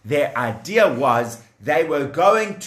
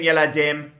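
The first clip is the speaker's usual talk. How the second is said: plain reading voice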